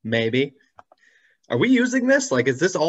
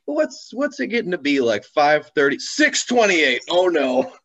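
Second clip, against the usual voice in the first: high, rising alarm as he checks the time, slowing on the numbers; a retold jolt of panic at being late; mock panic over the clock; startled realization; 5 semitones higher, 5 dB louder than usual.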